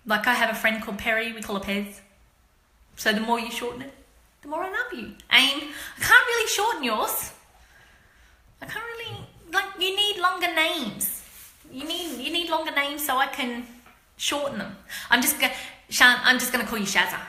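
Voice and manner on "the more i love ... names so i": playful high voice. Real, not acted. Playful talk to student.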